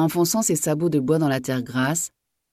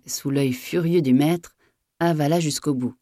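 ordinary delivery, nothing marked

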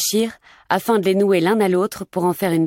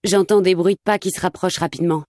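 ordinary delivery, nothing marked

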